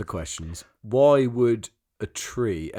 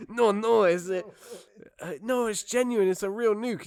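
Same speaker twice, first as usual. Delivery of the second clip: mock defensive dealer; 10 semitones higher than usual. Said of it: "the guy's like".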